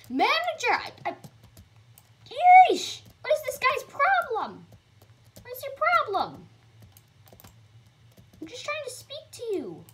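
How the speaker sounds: high, loud frustrated outburst; exasperated yell. Real, not acted. Gamer yelling at the rude manager, high and exasperated. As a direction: throw up your hands at him.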